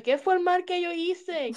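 slow, high mock-innocent whine; meme quote. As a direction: play dumb like the meme, stretched out slow. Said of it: "como dice el meme".

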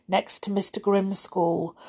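plain reading voice

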